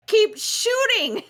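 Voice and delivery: high, drawn-out cry to comrades; mock battle shout. Player voices a character urging crew to fire, mock shout. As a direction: call it out high, stretching the words. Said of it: "then he starts shouting".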